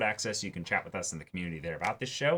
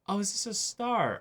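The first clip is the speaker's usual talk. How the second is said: lifted high, lightly mocking girl's voice; playful sister voice; 12 semitones higher than usual. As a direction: ask it like a sister poking fun, pitched way up. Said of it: "one of them was like".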